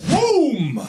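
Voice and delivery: high, drawn-out "whoom"; high falling boom-like effect; imitated whoosh noise. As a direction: make a big cartoon whoosh. Sound effects while waiting.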